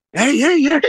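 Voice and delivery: high, startled calls of hey, imitating the moment; surprised hey. Coach caught off guard by a crying player, surprised high calls. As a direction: retell the surprise with a sharp upward jump. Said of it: "'cause we were surprised".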